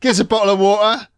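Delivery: put-on high-pitched Cockney kid, dropping the t's; Cockney accent. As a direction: pester Mum in a cheeky Cockney voice. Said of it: "who's been speaking Cockney English to him".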